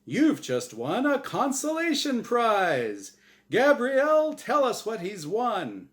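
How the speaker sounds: hyped game show host. Acted. Host insisting this is real, wild showman hype. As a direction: hype it up like live TV.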